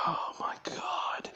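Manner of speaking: long sigh into a slow whisper; exasperated whisper. Retold or imitated. Boss learning where the escapee is, acted exasperated whisper. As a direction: breathe it out in acted despair, no voice. Boss's exasperated reaction, sighed.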